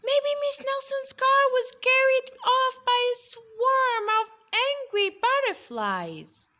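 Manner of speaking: put-on high, loud know-it-all child; know-it-all kid. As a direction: announce it with total confidence. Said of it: "one know-it-all".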